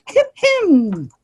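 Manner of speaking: high-pitched fake-polite throat-clearing sound; prim little witch's "hem hem"; witch in pink. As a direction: squeak it out as two tiny syllables, second held. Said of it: "dark witch in pink uttered through a toad-like".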